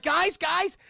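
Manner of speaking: urgent call to people elsewhere. Retold or imitated. Man calls to his family he hears, high urgent shout. Acts man calling to them.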